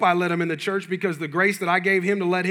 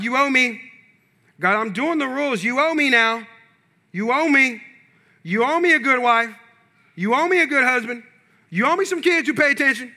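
building from plain to a higher, louder demand; demanding entitlement; 6 semitones higher, 4 dB louder than usual. Acted. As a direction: insist harder and harder that God owes you. Acting a demanding believer.

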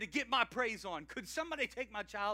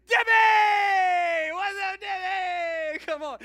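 much higher, loud, slow joyful call; excited shout; 12 semitones higher, 18 dB louder, much slower than usual. Shown as a demonstration. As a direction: holler a friend's name with delight. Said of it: "It's fun if we just say".

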